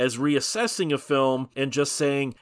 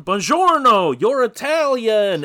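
mock Italian; 9 semitones higher, 8 dB louder, slower than usual. Jokey Italian greeting in ad.

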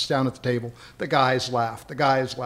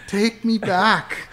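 higher, slow, drawn-out repeat of "Take me back"; slow high mock plea; 8 semitones higher, 7 dB louder, much slower than usual. Retelling the French girl's demand to friends, slow high mock plea.

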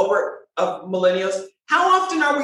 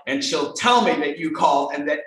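normal talking voice

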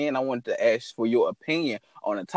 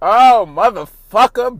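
loud curse; 8 semitones higher, 14 dB louder, slower than usual. Real, not acted. Cursing loudly, then laughing.

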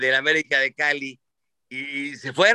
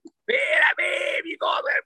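mock shout; much slower than usual. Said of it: "me dice".

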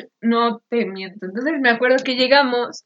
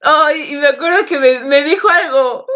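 ordinary talk, nothing marked

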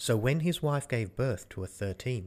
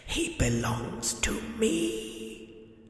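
Death; 3 semitones higher, 3 dB quieter than usual. Acted. Death voiced, long speech.

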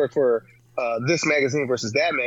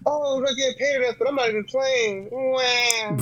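high, whiny mock complaint ending in a fake wah; sulky, babyish mock player; mock whining; 8 semitones higher, slower than usual. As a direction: whine like a spoiled player, hammed up.

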